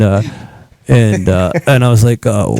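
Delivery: usual speaking voice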